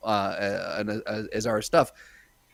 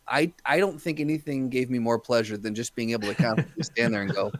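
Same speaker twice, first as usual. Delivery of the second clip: usual speaking voice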